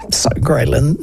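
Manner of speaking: ordinary delivery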